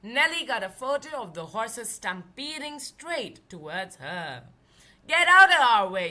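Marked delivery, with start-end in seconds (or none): 5.1-6.1 a big loud high shout; yelled. Acted. Stagecoach driver yelling at Nellie in the road, loud shout. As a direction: yell them out of the path. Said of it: "yelled the stagecoach driver".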